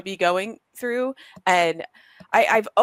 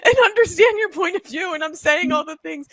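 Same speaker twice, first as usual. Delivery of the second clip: high, loud and exasperated, half laughing; pleading with the kids, voice pitched way up; mock frazzled parent at the end of her rope; 10 semitones higher, 4 dB louder than usual. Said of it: "I'm like".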